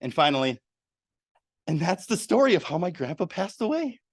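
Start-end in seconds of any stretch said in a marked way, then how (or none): 1.7-4.0 sad news told upbeat and higher; inappropriately cheerful. Shown as a demonstration. Demo of mismatched emotion, sad story told cheerfully. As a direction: tell a sad story brightly. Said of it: "didn't match up with the words".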